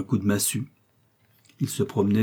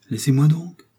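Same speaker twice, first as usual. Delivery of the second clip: irritated rebuff; 6 semitones higher, 5 dB louder than usual. Acted. Lheureux rebuffs her pleading touch.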